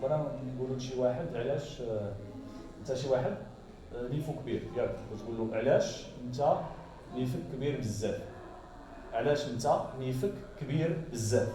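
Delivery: quick Moroccan Darija demo. Shown as a demonstration. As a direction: rattle it off in Darija, fast. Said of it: "in the Moroccan sentence".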